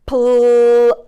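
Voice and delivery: plum drawn out on the uh; roller coaster word. Shown as a demonstration. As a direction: stretch plum over the hill. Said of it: "do your roller coaster".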